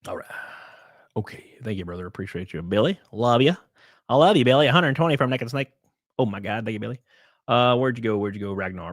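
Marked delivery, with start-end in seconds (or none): none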